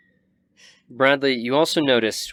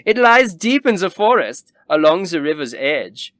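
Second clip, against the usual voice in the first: high, loud snooty noble; Wilhelm's voice; 7 semitones higher, 7 dB louder than usual. Acted. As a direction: describe the lodge with grand airs. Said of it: "And Wilhelm nods".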